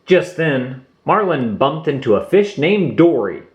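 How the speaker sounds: ordinary delivery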